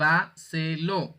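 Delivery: "llévaselo" split slowly into llé-va-se-lo; slow classroom syllable count on "llévaselo". Shown as a demonstration. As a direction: sound out "llévaselo" one syllable at a time. Teacher splits the word into syllables.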